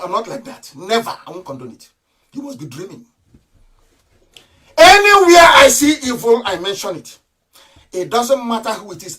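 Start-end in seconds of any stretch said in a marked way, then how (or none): 4.8-6.4 higher and louder, raging declaration; furious raised voice; angry shout. Real, not acted. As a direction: hurl it out in fury. Angry rant against opponents.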